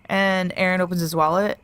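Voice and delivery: ordinary talk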